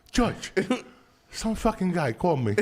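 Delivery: high, loud complaint; a hint of an indignant grandma; lightly voiced old lady, laughing; grandma complaining. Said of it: "she'd tell George about it".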